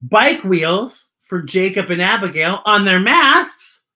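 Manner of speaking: ordinary delivery, nothing marked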